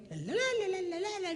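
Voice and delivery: high, sliding nonsense babble; mock high chattering; imitating receding chatter. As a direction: mimic wife chattering from further away. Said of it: "gets a little further away".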